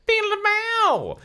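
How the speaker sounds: very high, slow, loud silly guitar noise; mock rock riff. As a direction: belt out a goofy rock riff. Imitating loud rock music in car.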